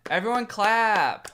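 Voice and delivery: slow, high hammed-up announcement; mock fanfare. Vlogger announcing the first S tier, mock fanfare. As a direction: proclaim it to a crowd, stretching the last words.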